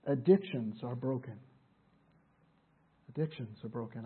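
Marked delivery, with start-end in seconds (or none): 3.1-4.0 softer, low restatement; hushed repeat. Real, not acted. Preacher repeats his point to the congregation, hushed. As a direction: say it back quietly, letting it sink in.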